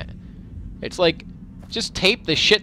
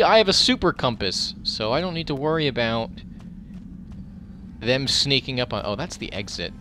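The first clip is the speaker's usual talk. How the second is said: ordinary delivery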